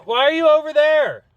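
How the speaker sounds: high-pitched, puzzled call to an animal; questioning a pet. Real, not acted. Keeper asking a straying bird why it is over there, high questioning. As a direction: ask it high, falling away at the end.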